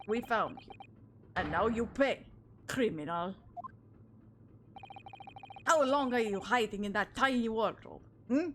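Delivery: put-on loud, gruff Russian-accented interrogator; Russian officer voice. Officer to stowaway he dragged from the wardrobe, mock Russian accent.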